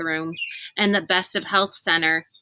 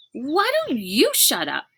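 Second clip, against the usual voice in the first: snapping; 6 semitones higher than usual. A narrator's reading. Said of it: "Rainey snapped".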